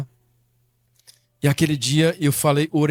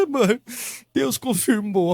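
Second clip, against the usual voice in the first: imitating a tearful wail, higher-pitched; retold tearful cry; 6 semitones higher, slower than usual. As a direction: mimic crying, dragging out the words. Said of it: "ela chorando". (imitando um choro emocionado)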